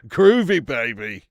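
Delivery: punchy impression with a big first word; Austin Powers. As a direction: belt out a groovy spy catchphrase. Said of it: "I love a bit of Austin Powers".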